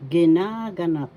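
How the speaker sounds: ginaganap said loudly with a long NA; stress on second syllable. Shown as a demonstration. Teacher modelling for learners, loud ginaganap stressed on NA. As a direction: punch and stretch NA in ginaganap. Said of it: "The second".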